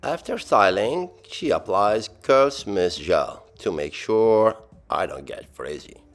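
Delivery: ordinary talk, nothing marked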